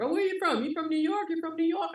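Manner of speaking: high-pitched mimicked pestering questions; nosy questioners. Southerners asking a New Yorker's origins, mock nosy. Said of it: "I was told, like, every time".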